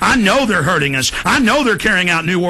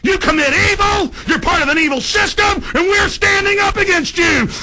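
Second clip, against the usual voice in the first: higher, yelling in outrage; furious shouting; 8 semitones higher than usual. Real, not acted. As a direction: scream it at them, furious.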